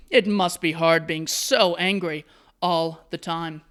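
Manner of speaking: normal talking voice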